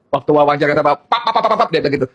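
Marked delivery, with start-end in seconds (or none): 0.8-1.7 quick popping pap sounds like shots; playful imitated rifle fire; mock gunfire. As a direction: spit out the shots like a burst of gunfire. Imitating gunfire during the interview.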